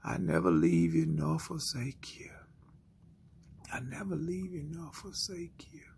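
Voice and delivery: hushed repeated whisper; still small voice. Acted. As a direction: whisper it like a voice inside the heart. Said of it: "that still small voice that says".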